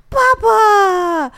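high, loud imitated child's wail for daddy; crying out for dad. Mother imitating her daughter crying after dad, high loud wail. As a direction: wail it like a little girl left behind. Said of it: "哭着喊他爸爸说".

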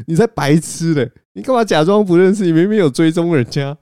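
high, loud mock-angry telling off; scolding back. Friend scolding speaker for faking ignorance, loud rebuke. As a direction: shout him down for playing dumb. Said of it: "然后他就反骂我说".

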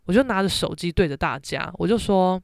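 ordinary talk, nothing marked

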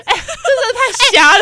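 high, slow, laughing; laughing disbelief. Real, not acted. Cohost reacting to a girl scoring zero, laughing. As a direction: say it through a laugh.

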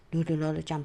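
ordinary delivery

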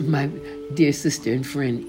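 usual speaking voice

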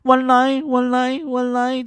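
high, dismissive auntie repeating one line; mock Korean auntie. Busy Korean auntie brushing off the queue, high mock voice. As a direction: brush off the customer in a high, mocking voice. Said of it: "那个韩国大妈就这样".

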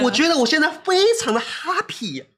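high, wired and giddy; over-caffeinated excitement; too much caffeine. Real, not acted. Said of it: "[name]到底喝了多少？". (咖啡喝太多的亢奋语气)

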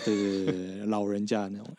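normal talking voice

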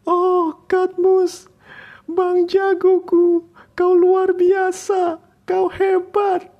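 very high squealing cheer, slow; cheerleader. Acted. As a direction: shriek it like a fan cheering. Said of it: "bersorak gembira bagaikan cheerleader".

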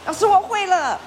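a student proudly reporting to the teacher; a hint of a child showing off; eager student. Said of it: "他也跟着唱了".

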